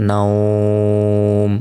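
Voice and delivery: নাওম held long and chanted, final ম closed; drawn-out stop on nawm. Shown as a demonstration. Teacher demonstrates the long vowel at a stop, chanting. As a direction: stretch নাওম like a reciter. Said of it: "টেনে পড়তে পারবো".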